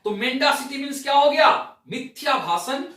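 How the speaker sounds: normal talking voice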